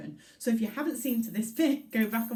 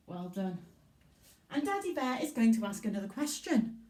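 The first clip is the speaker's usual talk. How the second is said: ordinary talk, nothing marked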